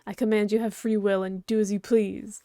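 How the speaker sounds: ordinary talk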